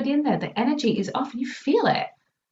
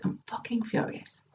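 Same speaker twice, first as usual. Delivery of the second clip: whispered, much softer confession; hushed admission; 10 dB quieter than usual. Retold or imitated. Client finally admitting her fury, in a whisper. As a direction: breathe it out like a secret. Client's real answer retold.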